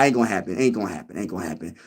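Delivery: dismissive repeat trailing off to nothing; fading dismissal. Acted.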